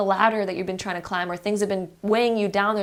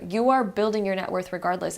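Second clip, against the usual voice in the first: ordinary delivery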